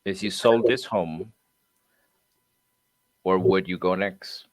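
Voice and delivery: "If you sold this home", long pause, "where WOULD you go next"; slow, emphasized WOULD. Shown as a demonstration. Trainee practises the coach's slow script, pausing before "where". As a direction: leave a long gap before leaning on "would". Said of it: "I like that. See, good job".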